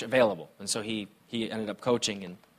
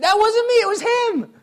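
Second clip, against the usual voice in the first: put-on much higher, loud childlike protest; upset child; 7 dB louder than usual. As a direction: squeal it like a wronged little kid. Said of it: "I said".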